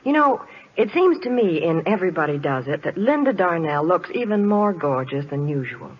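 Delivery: usual speaking voice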